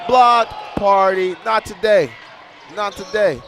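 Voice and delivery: high, loud, drawn-out hype; "not today" roared at the shooter; block party shout. Real, not acted. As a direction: yell it like the crowd after a block. Big block on a shot.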